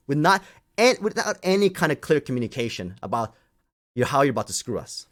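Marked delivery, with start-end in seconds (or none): none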